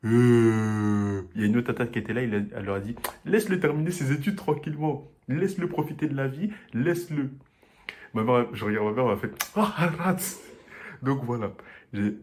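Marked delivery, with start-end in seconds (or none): 3.3-7.3 pleading aunt. Retold or imitated. Autre tata le défend. 9.6-10.4 higher mock exasperated mother; a mother's high, playful "Oh, arrête!"; mother's exclamation. La mère réagit.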